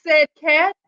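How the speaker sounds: put-on lively read-aloud for children; storybook reading. A narrator's reading. Principal reading a picture book to students, lively storytelling. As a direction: tell it like a picture book to a class.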